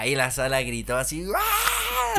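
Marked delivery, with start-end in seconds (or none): 1.3-2.2 very high excited shriek; high hammed-up fan scream; mock crowd scream. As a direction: squeal like the whole cinema. Cinema crowd reacting to Tobey.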